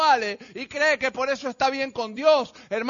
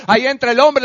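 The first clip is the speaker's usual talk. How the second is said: ordinary talk, nothing marked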